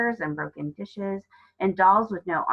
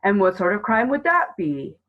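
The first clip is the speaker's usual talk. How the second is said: lightly voiced gruff officer, louder; a touch of gruff officialdom, raised volume; gruff policeman; 3 semitones higher, 6 dB louder than usual. A narrator's reading. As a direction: humour the caller as a policeman. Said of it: "a gruff male voice".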